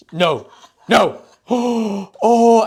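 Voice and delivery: high, loud gasping imitation; Irish girlfriend. Girlfriend seeing dog in a bow, gasping mock delight. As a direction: mimic an overcome animal lover, gasping. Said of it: "Like, that's her".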